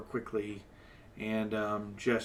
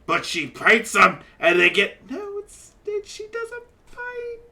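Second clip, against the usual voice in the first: flamboyant half-elf; 9 dB louder than usual. Acted. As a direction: coax him, full of flair.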